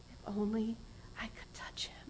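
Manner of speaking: soft, hushed longing; whispered hope. Acted. Bleeding woman wishing to touch Jesus, whispered. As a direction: breathe it as a secret wish. Woman's inner wish retold.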